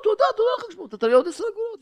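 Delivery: very high, innocent thanks; naive gratitude. Retold or imitated. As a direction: imitate a simple man gushing thanks. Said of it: "ובתמימות". (חיקוי הודיה תמימה בקול גבוה)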